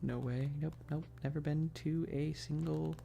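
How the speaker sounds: ordinary talk, nothing marked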